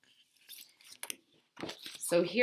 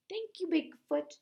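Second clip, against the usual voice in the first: higher, squeaky little bug thanking; tiny bug voice; 12 semitones higher, 5 dB quieter than usual. Retold or imitated. Teacher voicing the tiny bug thanking Bigfoot, high and small. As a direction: chirp it like a grateful little bug. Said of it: "the little bitty bug goes".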